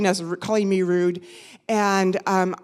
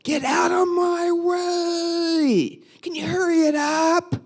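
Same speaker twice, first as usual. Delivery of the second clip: high, drawn-out angry holler; hollered, stretched-out impatient shout; yelling driver; 11 semitones higher, 5 dB louder, slower than usual. Retold or imitated. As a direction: imitate a man yelling from his car, loud and high. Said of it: "then he yells".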